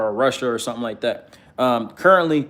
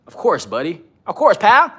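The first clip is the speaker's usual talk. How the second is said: hammed-up Canadian accent with lifted endings; mock Canadian, like a cartoon buddy; 6 semitones higher, 4 dB louder than usual. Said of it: "I'm getting my Canadian voice on".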